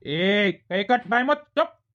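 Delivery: loud sleep command. Acted. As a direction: shout for silence like a strict teacher. Teacher-dream orders silence.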